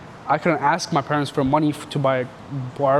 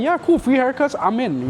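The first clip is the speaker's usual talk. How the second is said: eager friend; 9 semitones higher than usual. Retold or imitated. Friend agrees to haircuts.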